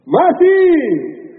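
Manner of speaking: loud shout at a very high pitch; grito. Shown as a demonstration. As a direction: cry it out high and long to the audience. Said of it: "voy a gritar". (grito fuerte, largo y muy agudo)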